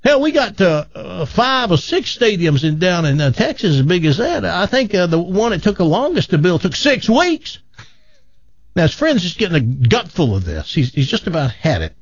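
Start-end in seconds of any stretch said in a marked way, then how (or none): 0.0-7.6 high, scoffing boastful Texan; bragging Texan. Acted. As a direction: scoff and brag like a big-talking Texan. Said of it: "He goes".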